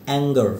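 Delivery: "অ্যাঙ্গার" ending in a weak schwa, like "ang-guh"; teacher's demo of "অ্যাঙ্গার" with a swallowed final a; barely sounded schwa. Shown as a demonstration. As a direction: stop the last vowel of "অ্যাঙ্গার" short. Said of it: "আটা উচ্চারণ না হবার মতো".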